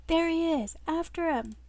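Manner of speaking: high, mildly shouted chase cry; urgent call. Acted. Men in suits chasing a runaway, urgent calling. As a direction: call out the chase, a bit restrained. Reader redoes the chase line.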